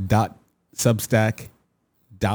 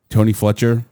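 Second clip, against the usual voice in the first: ordinary delivery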